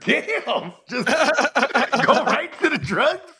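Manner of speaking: retold mock protest, high-pitched through laughter; laughing disbelief. Caller blames drugs, so he retells his reply with laughing disbelief.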